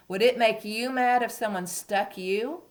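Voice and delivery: ordinary delivery, nothing marked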